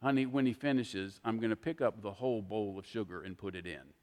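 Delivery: wife gently answering her fretting husband; gentle and nice. Retold or imitated. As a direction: calmly set him straight, kindly. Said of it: "very nicely but very gently".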